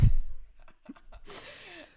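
high-pitched amused laugh; burst of laughter. Real, not acted. Telling friend of police report, high laugh. Laughs at own shooting story.

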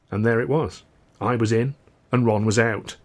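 usual speaking voice

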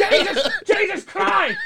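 high, drawn-out mock shout; brother yelling Jesus Christ. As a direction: yell it like a stubbed toe. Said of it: "The way his brother was saying".

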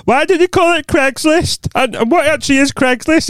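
high-pitched mimicry of co-host's questions; mock distracted co-host. Teasing co-host over ADHD, high mocking impression. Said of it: "and you're like".

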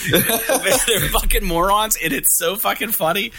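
high, slow words pushed through real laughter; cracking up while getting the words out; laughing. Real, not acted. Podcaster recounting Beavis and Butthead, laughing hard.

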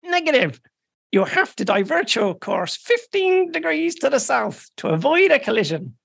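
high, firm radio refusal. Acted. Irish officer refuses the British ship, high and firm.

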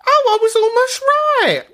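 high, loud pleased cry; thrilled to be nearly right; delighted surprise. Real, not acted. Host hears the translation and cheers herself, excited. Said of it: "I should give myself more credit".

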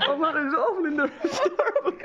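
high-pitched mock sobbing through laughter; hammed-up tearful squeal; pretend crying. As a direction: wail it while trying not to laugh. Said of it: "where you pretend to cry".

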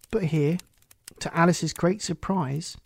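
ordinary delivery, nothing marked

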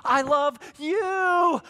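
high, drawn-out lovey-dovey declaration; mock movie romance. Preacher spoofing a Hallmark movie love scene, syrupy mock romance. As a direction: gush it slowly, hammed-up and high.

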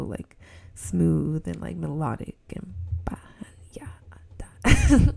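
slow, half-whispered smooth delivery; smooth podcast voice. Shown as a demonstration. Host showing listeners her ideal podcast voice, slow and whispery. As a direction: breathe the words out slow and silky like a radio host. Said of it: "it just sounds so".

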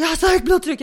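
loud, high-pitched complaint; agitated neighbour. Retold or imitated. Neighbour fending off complaint at the door, agitated. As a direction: cry it out as the worked-up neighbour.

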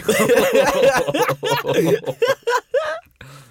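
long, loud, high laughing fit; real hysterical laugh; helpless laughter. Real, not acted. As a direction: crack up completely. Cracks up at the quoted line.

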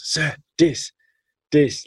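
put-on a delighted boy showing his cup of rice; kid saying dis. Teacher imitates the boy with his rice, high excited "dis". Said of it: "he couldn't pronounce the T H".

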